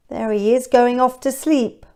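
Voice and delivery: usual speaking voice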